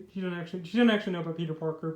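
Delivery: ordinary talk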